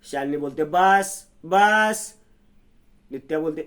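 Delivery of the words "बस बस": angry stop. Acted. Shalini cuts off Nitya's questions.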